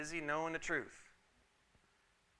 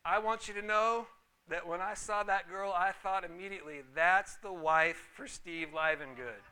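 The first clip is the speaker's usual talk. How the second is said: louder voice calling out, slowing at the end; retold holler; 3 semitones higher, 7 dB louder than usual. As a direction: call across the lot to a friend. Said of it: "he hollers out at me".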